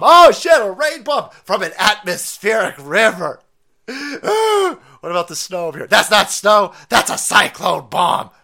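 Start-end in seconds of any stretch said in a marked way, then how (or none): 0.0-3.4 high, loud fake panic; high, loud mock panic; mock alarm. Mock alarm at rain bomb. 3.9-4.8 high hammed-up gasp; mock gasp.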